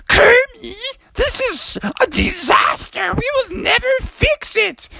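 very high, distraught pig squeal; Miss Piggy. Acted. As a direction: wail it as a melodramatic diva. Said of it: "And Piggy said".

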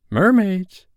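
put-on high, loud boyish lure; boy dangling an adventure; enticing boy. A narrator's reading. Peter luring the hesitant Wendy.

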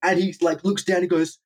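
usual speaking voice

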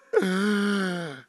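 put-on sharp gasp of a boy surfacing; mock gasp after being dunked; gasp for air. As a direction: gulp air like you were held under.

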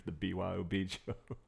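ordinary talk, nothing marked

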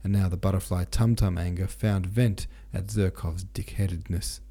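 plain reading voice

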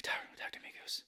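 mock-dramatic movie whisper; whispered plea. Maverick holding dog tags, talking to Goose, whispered. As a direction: whisper it as if to a lost friend.

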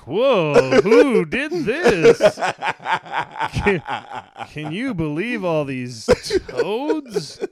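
high, loud, slow amazed cry with laughter; mock astonishment. Australian local staring at the toads, mock shock. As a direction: gawp at the toads, hammed-up. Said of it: "that guy was probably like".

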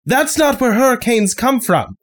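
pointed, impatient correction; frustrated sheriff. Acted. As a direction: drive the obvious point home.

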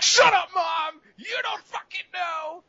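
very high screamed tantrum; acted furious yell. Raging gamer screams back at Mom, furious mock tantrum. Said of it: "And you're like".

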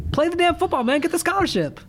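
high exasperated urging; pleading advice. Real, not acted. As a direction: talk sense into the player, high and pushy. Urging the character emphatically.

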